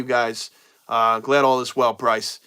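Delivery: ordinary delivery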